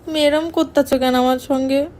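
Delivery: ordinary delivery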